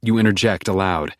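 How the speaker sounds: usual speaking voice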